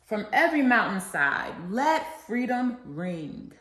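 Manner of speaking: rousing oratory with a preacher's cadence; formal speech. Acted. Student reciting the I Have a Dream speech to listeners, rousing oratory. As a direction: proclaim each line like a civil rights speech to a crowd.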